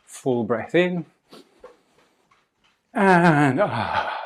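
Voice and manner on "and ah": loud, long breathy "ah" sigh; satisfying sigh out. Shown as a demonstration. Teacher showing class a satisfying sigh, loud exhale. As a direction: let a big sigh go after a full breath. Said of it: "satisfying sigh out".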